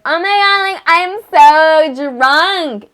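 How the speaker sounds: high, loud mock-drunk squeal, dragging out the end; drunk party girl. Drunk people at parties announcing it, hammed-up squeal. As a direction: impersonate someone bragging about being drunk. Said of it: "those people at parties who are like".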